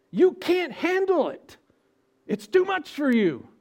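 preaching at full force; forceful rebuke. Acted. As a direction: hammer home that they can't handle it. Preacher addresses proud humans.

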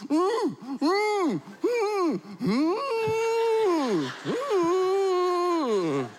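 high, straining mm sounds; mute frantic humming. Acted. Mute Zechariah trying to answer the people, high and frantic. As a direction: try to speak with your mouth shut. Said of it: "Zechariah, what happened?".

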